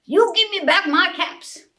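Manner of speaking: a peddler shaking his finger at monkeys; peddler scolding. A narrator's reading. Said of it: "he said, shaking a finger at them".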